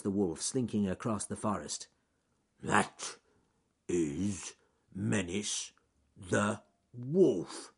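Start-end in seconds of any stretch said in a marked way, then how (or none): 2.6-7.7 very slow, halting bear voice; bear thinking to himself. Acted. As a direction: work it out one word at a time. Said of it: "he thought to himself".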